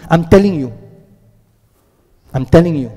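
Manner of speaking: "telling" firmly stressed; serious emphasis. Retold or imitated. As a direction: press on "telling" to show you mean it. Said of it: "is emphasis. Allah is serious".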